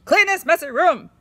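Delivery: high, loud exclamation; mother exclaiming loudly at a messy room; exclaimed. Shown as a demonstration. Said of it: "exclaimed Mother".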